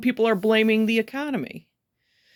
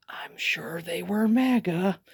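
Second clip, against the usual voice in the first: conspiratorial whisper, slowed down; whispered aside; 4 dB quieter, slower than usual. Real, not acted. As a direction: drop to a secret whisper. Whispered aside about studio owners.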